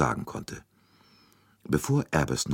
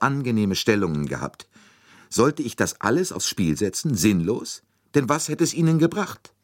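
raised, emphatic self-defence; indignant governess; 7 semitones higher, 4 dB louder than usual. A narrator's reading. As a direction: justify yourself heatedly, pitch climbing.

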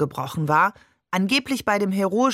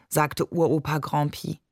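usual speaking voice

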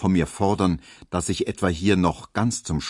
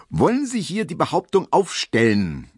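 sharp interruption; 8 semitones higher, 4 dB louder than usual. A narrator's reading. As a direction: cut in high and loud, the judge. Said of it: "unterbrach der Richter".

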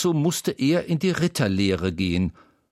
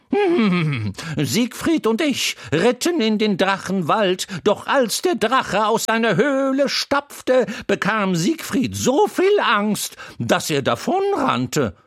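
much higher, louder cheeky horse voice; a chatty horse teasing the crying boy; Troubadour the talking horse; 12 semitones higher, 4 dB louder than usual. A narrator's reading. Horse Troubadour comforting the crying boy Wendel, high and loud.

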